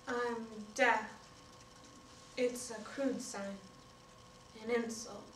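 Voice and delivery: slow, softened admission; subdued hurt. Acted. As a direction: let it out quietly, with long pauses. Mother's hurt reply, acted.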